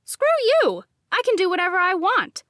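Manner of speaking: loud, high, fast retort; defiant snap. A narrator's reading. Narrator shouts back at her inner voice, defiant. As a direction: bite back hard and quick. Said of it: "I shot back at the voice".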